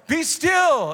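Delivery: loud, high theatrical command; dramatic. Acted. Jesus speaks to the storm, dramatic. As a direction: belt it out with grand drama. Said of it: "imagine it in some dramatic way".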